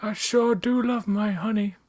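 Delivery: high-voiced character impression; Winnie the Pooh. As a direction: pitch it up like a honey-loving storybook bear. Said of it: "Is that a good poo?".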